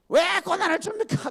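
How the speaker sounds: high, loud whiny protest; mocking a believer's loud whiny complaint; mock tantrum. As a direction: whine like a spoiled child.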